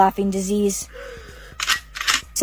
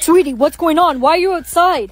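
higher, worried questioning; alarmed concern; 5 semitones higher, 3 dB louder than usual. Acted. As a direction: ask the child urgently, pitched up. Alarmed neighbour questions child.

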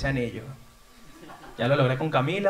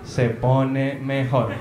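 slow teasing aside; 4 semitones lower, much slower than usual. Pretend. Comic slow aside about vacation.

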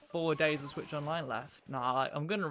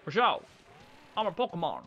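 playful shouted Obama imitation, louder; Barack Obama impression; 3 semitones higher, 7 dB louder, faster than usual. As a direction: bellow it like a joking Obama. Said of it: "That's my Barack Obama impression".

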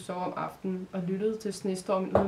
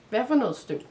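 mildly irritated question, higher and louder; 5 semitones higher, 5 dB louder than usual. A narrator's reading. Irritated girl questioning the boy about dust, mildly annoyed. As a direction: ask it with a touch of annoyance. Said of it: "Maja fnys irriteret".

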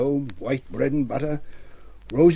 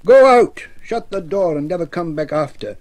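commanding shout; 8 dB louder than usual. Acted. Tom ordering Barrow-wight out of his house, commanding shout. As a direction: drive the ghost out with a shout.

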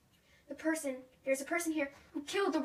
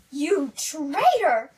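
slow, loud drawn-out cry of betrayal; outraged accusation; 10 dB louder, much slower than usual. Pretend. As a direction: spit out the accusation. Accusing the plotting villain.

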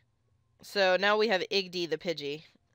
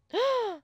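sharp gasp of delighted surprise; excited gasp; 4 dB louder than usual. Real, not acted. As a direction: gasp at a lucky find. Spots a rare Pokemon.

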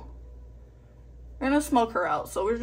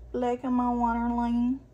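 ordinary talk

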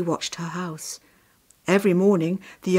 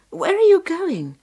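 put-on high, loud child's question; high-pitched young sister, insistent; little sister; 12 semitones higher, 8 dB louder than usual. A narrator's reading. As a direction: pipe up as a puzzled little girl. Woken little sister questions her brother.

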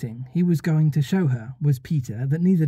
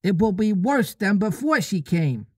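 put-on lost boys; 5 semitones higher than usual. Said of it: "they cried".

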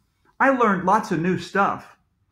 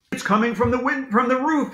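higher, urgent half-shout; mild shout; 8 semitones higher than usual. A narrator's reading. The principal shouts to the children about the roof, mildly. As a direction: call it out urgently without much volume. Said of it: "he shouted".

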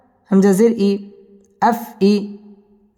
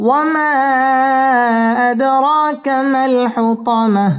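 slow, sung tajweed chant; Quran recitation; 5 semitones higher, much slower than usual. Shown as a demonstration. Teacher reciting the surah for students to follow, chanted. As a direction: let each line ring out melodically. Recites verse after spelling.

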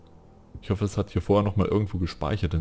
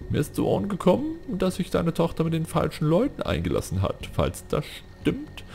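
lightly voiced, higher warning voice, slowing at the end; mock sinister messenger; 10 semitones higher than usual. Streamer reads out a threatening message, high mock voice. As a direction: read the threat like a shady informant.

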